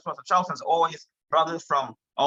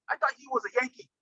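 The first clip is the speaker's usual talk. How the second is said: imitating island friend; 8 semitones higher, 4 dB quieter than usual. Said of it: "the brother in front said".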